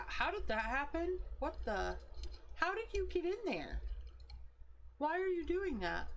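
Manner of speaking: catching something odd on the mic, high and startled; surprised. Real, not acted. Streamer spots something on her microphone, startled and high.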